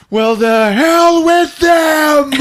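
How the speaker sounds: high, loud, drawn-out outburst; defiant shout. Retold or imitated. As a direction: yell it slowly, ready to fight. Acted outburst before attacking dolls.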